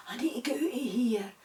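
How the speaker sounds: quick breathless whisper to himself; urgent whisper. Acted. Trapped fly whispering to himself, urgent whisper. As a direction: whisper fast, trapped and anxious.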